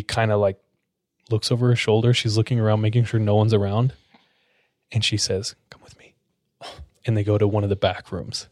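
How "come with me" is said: very soft, furtive retold whisper; secretive whisper. Nurse drawing the tech aside, in a secretive whisper. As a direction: whisper it so nobody else hears.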